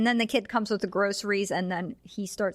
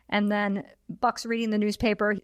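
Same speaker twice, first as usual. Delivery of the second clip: ordinary delivery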